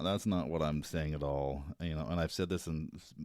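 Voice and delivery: ordinary talk, nothing marked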